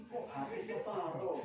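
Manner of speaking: soft, musing question; quiet puzzlement. Retold or imitated. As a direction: repeat it to yourself, puzzled. Puzzles over the overheard shout.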